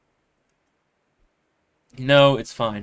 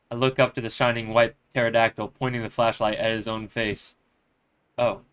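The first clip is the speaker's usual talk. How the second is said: ordinary talk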